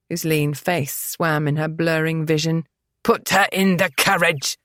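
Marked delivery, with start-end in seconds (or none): 3.0-4.6 curt order to his brother; commanded. A narrator's reading. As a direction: give a brisk command. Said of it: "Peregrine commanded".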